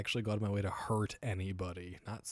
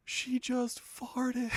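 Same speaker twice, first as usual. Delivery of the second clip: put-on much higher, very slow little kid voice; young boy voice; much slower than usual. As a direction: imitate a small boy announcing it slowly. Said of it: "my oldest boy goes".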